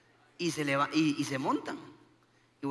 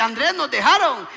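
raised, slow, loud cry of alarm; mock panic; 4 semitones higher, 8 dB louder than usual. Wife telling husband they've been left behind, mock panic. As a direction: act out a partner's alarm, drawn out.

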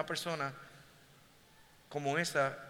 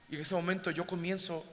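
ordinary talk

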